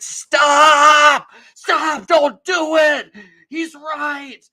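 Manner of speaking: loud, high screamed plea; screaming. Retold or imitated. Older lady stopping a mob from attacking, screaming. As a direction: scream to stop them, stretching it out.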